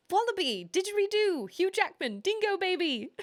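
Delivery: high, mock Australian accent; hammed-up Australian, pitched high; Aussie accent. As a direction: reel off words in a put-on Aussie voice. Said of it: "how is my Aussie accent?".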